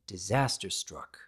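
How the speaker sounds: usual speaking voice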